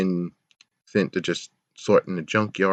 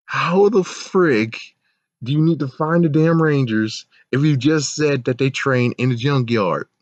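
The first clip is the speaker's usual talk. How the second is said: exasperated; 5 semitones higher than usual. Real, not acted. Ranting about plot hole.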